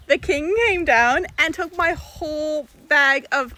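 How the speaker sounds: loud, high, slow and astonished; can't believe what just happened; excited. Real, not acted. Telling the camera the king monkey stole bananas, excited.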